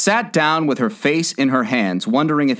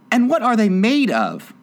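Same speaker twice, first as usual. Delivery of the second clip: curious; 10 semitones higher than usual. A narrator's reading. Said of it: "in a tone of great curiosity".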